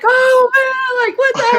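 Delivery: high-pitched mock panic cry; freaked out. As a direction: imitate a friend freaking out. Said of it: "he truly is freaked out".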